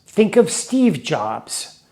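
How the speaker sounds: slow, deliberate teacher pacing; slow explaining. Shown as a demonstration. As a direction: spell it out slowly for learners. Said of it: "invent a way of remembering this".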